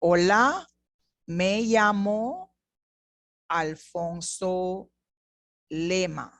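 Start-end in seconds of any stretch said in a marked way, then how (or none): 1.3-6.3 slow and clear for learners to repeat; me llamo Alfonso said slowly; slow repetition. Shown as a demonstration. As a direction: model it slowly.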